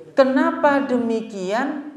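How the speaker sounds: ordinary talk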